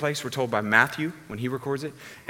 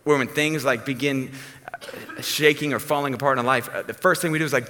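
ordinary talk

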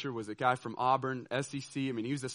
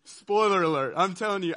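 normal talking voice

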